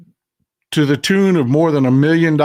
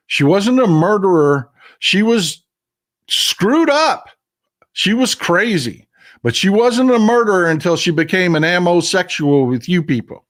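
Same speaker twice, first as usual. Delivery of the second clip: indignant, higher-pitched outburst; angry rebuke; 5 semitones higher than usual. Real, not acted. Host blaming gun obsessives for the shooting, angry. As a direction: hammer it out in exasperated, high-pitched protest.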